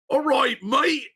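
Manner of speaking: loud, high cartoon Australian; hammed-up Aussie greeting; mock Australian accent. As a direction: put on a broad Australian accent. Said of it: "Just do Australian".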